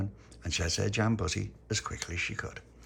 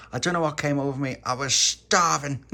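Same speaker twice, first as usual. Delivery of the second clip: raised, loud, stretched-out exclamation; exclaiming Tony; 7 semitones higher, 8 dB louder than usual. A narrator's reading. Tony excusing his jam-eating, exclaiming high and loud.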